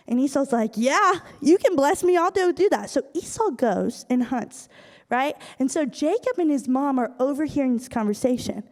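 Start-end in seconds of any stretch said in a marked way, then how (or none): 0.8-2.9 higher, louder eager son; lightly acted keen yes; eager agreement. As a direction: jump at the offer, mildly excited. Esau eagerly agreeing to father's request.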